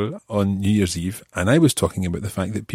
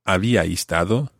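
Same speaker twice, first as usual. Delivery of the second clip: ordinary talk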